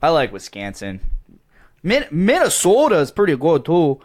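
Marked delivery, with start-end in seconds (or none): 1.8-4.0 higher, louder folksy Minnesotan lilt; Minnesota accent. Pretend. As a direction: sing it like a Minnesota local. Mock Minnesotan accent talk.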